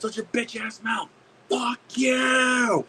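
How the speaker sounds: high put-on voice rising into a holler; mock angry retort. Heckler firing back in role play, mock angry. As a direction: scold high, then yell the last words long. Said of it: "let's do the role play".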